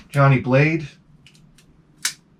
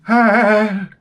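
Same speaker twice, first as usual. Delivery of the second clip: short higher yelp of pain; mock pained gasp; 6 semitones higher than usual. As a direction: let out a hurt little "Ah". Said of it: "You're like".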